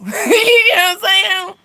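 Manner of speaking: real laughter bubbling through high, slow words; laughing. Real, not acted. Laughing at her own retort.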